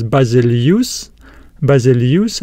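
Basilius with a "yoos" ending; alternative pronunciation. Shown as a demonstration. Teacher showing how others say the word, Basilius ending in "yoos". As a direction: say Basilius as ba-zi-li-YUS. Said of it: "they would pronounce this word as".

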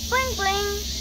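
high cutesy aegyo voice; breathy cutesy giggly. Acted. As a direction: coo it like a cute kid. Cute outro sign-off.

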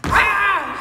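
loud rah cry; shout. Shown as a demonstration. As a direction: bark it out. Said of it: "you gotta mean it".

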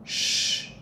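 sh hushed with rounded lips; a soft hushing sh; sh sound. Shown as a demonstration. Teacher showing the sh, like hushing a child to pee. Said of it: "Còn cái âm này nó sẽ là".

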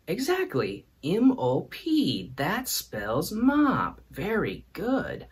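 slow, high-pitched patronizing praise; exaggerated, singsong congratulations, very slow; mock praise. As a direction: draw it out as if praising a small child. Mock praise for spelling mop.

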